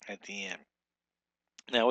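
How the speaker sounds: normal talking voice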